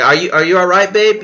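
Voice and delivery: loud, high-pitched acted check-in; alarmed concern. Boyfriend asking if girlfriend is all right, alarmed.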